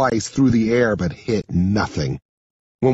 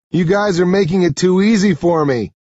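raised, mildly mocking challenge; higher-pitched fighter's goading; cocky taunt; 8 semitones higher, 4 dB louder than usual. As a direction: call out a confident taunt. Narrator taunts attackers mid fight.

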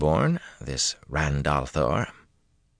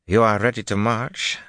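higher-pitched, curt question; curt demand; 6 semitones higher than usual. A narrator's reading. Commander questioning her kneeling officer, curtly demanding. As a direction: demand an answer as a superior officer. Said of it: "she demanded curtly".

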